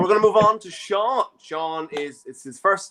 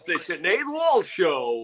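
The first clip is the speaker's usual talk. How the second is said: drawn-out mock show announcer; slower than usual. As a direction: announce the show slowly, half laughing.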